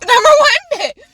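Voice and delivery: laughing, high and loud; number one pick, yelled through a laugh; laughing shout. Real, not acted. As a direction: burst out laughing on the repeat.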